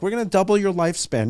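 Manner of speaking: high, fast salesman voice; mock tech pitch. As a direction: parody an eager tech company's promise. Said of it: "They're like".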